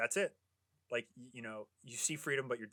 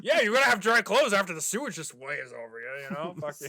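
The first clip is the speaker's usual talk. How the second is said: sarcastic; 3 semitones higher, 7 dB louder than usual. Retold or imitated. Mocking the characters' sewer plan.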